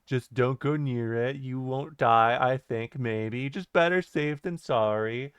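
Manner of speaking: deadpan, lilting countdown-narrator drone; mock cautionary narrator voice; Chills. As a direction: wrap up like a list-video narrator. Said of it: "your Chills impression".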